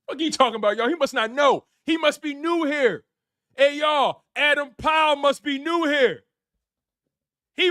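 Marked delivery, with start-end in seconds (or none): none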